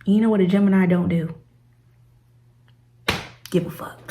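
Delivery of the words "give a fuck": breathy whispered aside; whispered punchline. Real, not acted. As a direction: drop it to a whisper for the joke.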